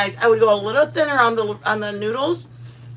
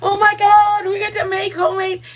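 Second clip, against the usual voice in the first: excited; 10 semitones higher than usual. Retold or imitated. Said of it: "So that's why we were like".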